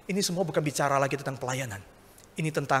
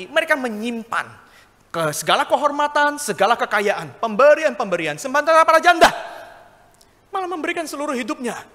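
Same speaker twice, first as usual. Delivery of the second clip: building louder and higher through the list; preaching at full force; 9 semitones higher, 7 dB louder than usual. Acted. Preacher to congregation, building into a fiery peak. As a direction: climb to a ringing peak on "janda".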